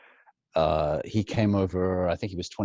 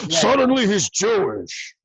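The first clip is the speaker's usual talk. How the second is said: higher, louder, slow hammed-up voice; over-the-top Pacino; 11 semitones higher, 6 dB louder than usual. Mocking Pacino's later style, high, loud and slow. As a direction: ham it up like late Pacino.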